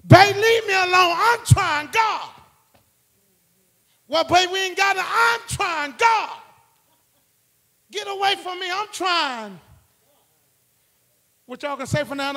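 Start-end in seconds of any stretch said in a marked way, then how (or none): 0.1-2.3 loud, higher, dragging I'm trying God slow; mock irritated husband. Man waving off his wife while trying God, loud and stubborn. 4.1-9.6 mock irritated husband. Husband brushing off his wife.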